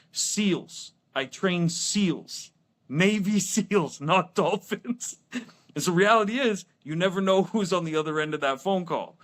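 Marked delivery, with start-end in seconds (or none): 0.1-5.2 slow, higher-pitched, word-by-word punchline; exasperated correction. Retold or imitated. Son correcting his dad for the last time, mock exasperated. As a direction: spell it out for him with mock patience.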